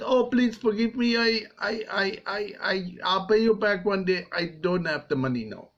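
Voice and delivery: high, stammering plea; stammering debtor. Acted. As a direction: stumble over the words, begging. Debtor begs the servant.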